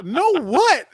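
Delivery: loud, high-pitched confused retort; retold outburst of confusion; incredulous. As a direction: play up total bafflement, loudly. Retells his confused reply to Paul.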